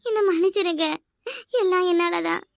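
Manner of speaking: tearful, put-on apology; crying. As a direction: plead with the husband through sobs. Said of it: "இப்ப நீ வேற ஏமா அழுற?". (அழுதபடி மன்னிப்பு கேட்கும் குரல்)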